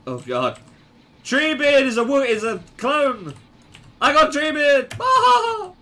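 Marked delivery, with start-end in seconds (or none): 1.2-5.7 high, loud battle yelling; worked-up gamer shouting; excited alarm. Real, not acted. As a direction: call out the enemy, rising to a shriek. Spots and fights an enemy player.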